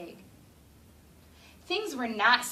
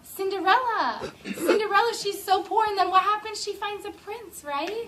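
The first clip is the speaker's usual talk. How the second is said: excited teacher; 9 semitones higher, 5 dB louder than usual. Real, not acted. Teacher excited by child's answer.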